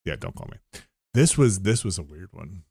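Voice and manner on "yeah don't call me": much lower and softer under the breath; muttered aside. Real, not acted. Agreeing with a friend, a low soft aside.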